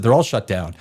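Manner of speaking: ordinary delivery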